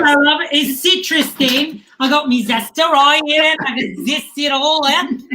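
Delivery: chirpy mock Aussie, zester in hand; Australian accent. Mark shows off his zester to the hosts in a put-on Australian accent. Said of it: "That was the best Australian accent I've heard all quarantine".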